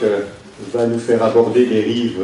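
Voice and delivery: usual speaking voice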